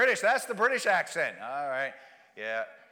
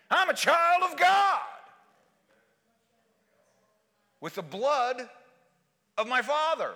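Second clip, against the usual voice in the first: loud, high, impassioned declaration; shouted testimony with pauses between phrases; full-force preaching; 7 semitones higher, 6 dB louder than usual. Real, not acted. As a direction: proclaim it to the whole church at the top of your voice.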